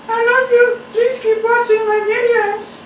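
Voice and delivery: pleading sweetly in a high falsetto; back talking. Acted. Creator's back begging viewers to keep watching, high squeaky falsetto. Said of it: "That was my back talking".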